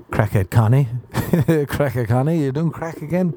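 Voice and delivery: usual speaking voice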